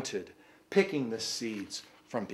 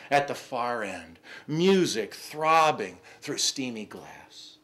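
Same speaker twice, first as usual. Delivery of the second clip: rising, louder poetry recital voice; poem reading; 3 semitones higher, 5 dB louder, slower than usual. Acted. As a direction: read it like a dramatic poem. Reads poem aloud.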